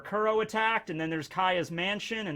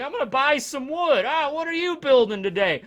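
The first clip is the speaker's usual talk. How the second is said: higher, louder cheerful cashier; chirpy shop clerk; 8 semitones higher, 5 dB louder than usual. Retold or imitated. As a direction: chat like a store worker. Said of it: "just like".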